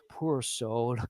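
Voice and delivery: soft, slow, drawn-out film line; Val Kilmer. Retold or imitated. Co-host quotes the dying-duel line to his friend, soft slow impression. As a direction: say it hushed and unhurried, as an imitation. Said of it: "like, Val Kilmer says".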